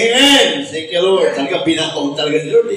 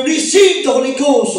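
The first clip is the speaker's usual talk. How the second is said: higher, louder ministering call; 11 semitones higher, 4 dB louder than usual. Acted. Preacher ministering to a seeker, loud call. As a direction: call it out over the believer. Calling out Holy Ghost.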